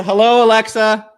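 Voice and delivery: mock greeting to a smart speaker; calling out to a smart speaker. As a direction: hail the speaker like a device.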